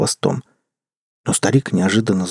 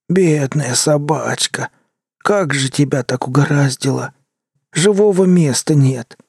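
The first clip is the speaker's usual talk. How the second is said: put-on slow, higher old tramp voice; pitying tramp; 5 semitones higher than usual. A narrator's reading. The tramp pitying the dog, slow and higher. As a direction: coo over the poor dog.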